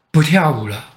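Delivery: ordinary delivery, nothing marked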